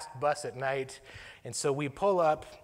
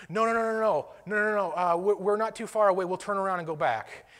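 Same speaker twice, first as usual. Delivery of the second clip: raised, louder, hurried refusal; urgent reply; 5 semitones higher, 5 dB louder than usual. Retold or imitated. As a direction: cut in eagerly with a higher voice. Phone owner urgently replies.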